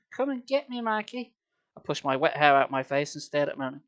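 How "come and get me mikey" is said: put-on taunting little girl. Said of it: "she taunted".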